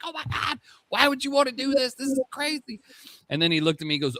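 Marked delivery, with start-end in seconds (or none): 0.0-2.8 high-pitched mock parental panic; retold freak-out. Dad confronting son over a vape, mock freaking out. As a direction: squeal it like an appalled parent, without much volume. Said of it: "I was freaking out".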